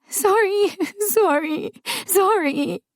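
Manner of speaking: put-on high, sobbing child's sorry; child crying repeated apologies. A narrator's reading. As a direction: blubber it out through sobs like a little girl. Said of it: "she burst into sobbing, kept saying".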